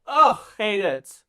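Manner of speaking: hammed-up socialite distaste; emphatic disdain. Noble answering about shucking corn, emphatic disdain.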